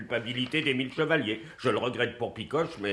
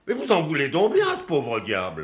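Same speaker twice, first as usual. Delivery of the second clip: exasperated; 5 semitones higher, 4 dB louder than usual. Acted. Exasperated accusation of colleague.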